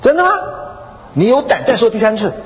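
high-pitched challenge; acted-out indignant dare; defiant husband. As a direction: dare her, refusing to back down. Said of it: "还是有点不太服气".

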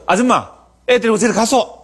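high, dismissive dialect shoo; disciples shooing a mother. Retold or imitated. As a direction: brush the woman off bluntly. (높고 퉁명스러운 사투리로 아줌마 쫓기)

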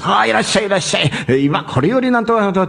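a hint of a fairground barker; circus barker. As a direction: imitate a circus tout pulling people in. Circus barker calling crowd in.

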